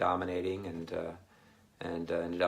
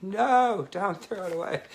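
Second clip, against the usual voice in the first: much higher, louder alarmed cry; mock protest; 11 semitones higher, 6 dB louder than usual. Canadian viewers begging him not to throw money away, high alarmed cry. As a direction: shriek it like viewers panicking. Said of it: "People are like".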